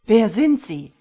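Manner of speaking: high, very loud desperate shout; screaming. Acted. Husband screaming at the killer, terrified shout. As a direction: scream the question in terror. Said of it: "schrie Tim mittlerweile".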